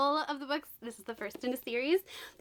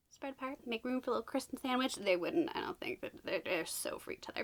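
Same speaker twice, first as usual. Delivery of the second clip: softer, cheeky hush; 6 dB quieter than usual. Real, not acted. Vlogger jokes about a risky pairing, in a hush. Saucy aside continues quietly.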